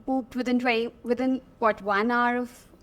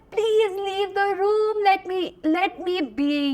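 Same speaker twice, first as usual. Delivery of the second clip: high, drawn-out mock scream; mock wail, slow and high, easing off at the end; retold scream; 7 semitones higher than usual. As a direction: reenact a desperate plea to be left alone. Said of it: "I scream and I'm like".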